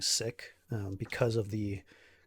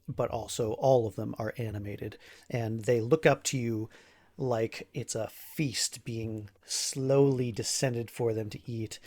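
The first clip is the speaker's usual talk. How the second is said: ordinary talk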